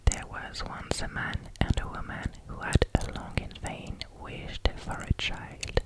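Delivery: ordinary delivery, nothing marked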